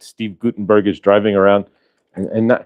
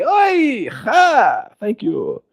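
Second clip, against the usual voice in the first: movie parody voice; 13 semitones higher, much slower than usual. Acted. Closing the Spaceballs sequel bit, cartoonish voice falling away.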